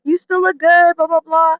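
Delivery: high, dismissive imitation of flattery; reassuring friends. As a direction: mimic friends' empty compliments. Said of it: "my family or friends would be like".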